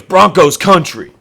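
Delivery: slow, drawn-out, loud "Broncos country"; hype intro. Retold or imitated. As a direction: stretch out "Broncos country" like a big hype call. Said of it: "he goes".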